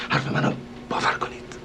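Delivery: soft whisper, confidential; whispered plea. Acted. Host quietly begging the lady to trust him, whispered. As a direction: whisper it as a secret promise.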